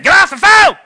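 high, loud mock yell of a mom; mother yelling. Mother yells at her son to get off the phone, loud mock yell. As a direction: bark it like an annoyed mother.

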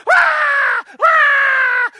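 very high, loud mock toddler wail; child wailing. Preacher imitating a crying four-year-old, loud wail. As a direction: wail like a hurt little kid. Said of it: "you hear the four-year-old".

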